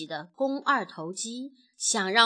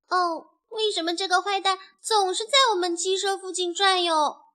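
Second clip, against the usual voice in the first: high-pitched little chick voice, wondering; puzzled; 7 semitones higher, 4 dB louder than usual. A narrator's reading. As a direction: ask in a high, childlike, baffled voice. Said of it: "不解地问". (不解地尖声发问)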